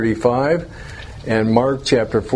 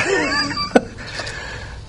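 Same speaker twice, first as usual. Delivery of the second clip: high laugh; 5 dB quieter than usual. Real, not acted. Laughs at own admission.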